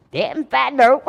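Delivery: loud, laughing shrug of a line; laughing answer. Retold or imitated. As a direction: crack up while answering. Answering cop while laughing.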